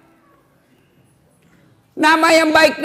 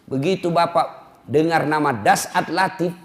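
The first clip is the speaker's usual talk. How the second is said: usual speaking voice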